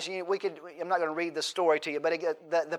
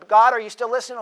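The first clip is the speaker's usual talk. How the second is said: higher, louder call to someone drifting off; mock attention-getting; 6 semitones higher, 10 dB louder, faster than usual. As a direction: pretend to check the listener is still there. Mimics nagging God for attention.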